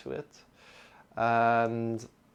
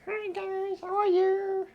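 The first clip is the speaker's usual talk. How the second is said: much higher squeaky dragon greeting; dragon puppet voice; 4 dB louder, slower than usual. Acted. Teacher voicing Sean the water dragon greeting the class, high cartoon voice. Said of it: "That's Sean".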